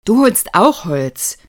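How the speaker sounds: loud, high, slow order; commanding. A narrator's reading. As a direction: lay down the law loudly. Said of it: "befahl er Lukas".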